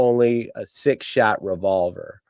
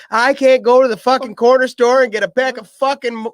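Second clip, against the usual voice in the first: mocking taunt; 13 semitones higher than usual. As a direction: jeer at her in a raised voice. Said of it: "He says".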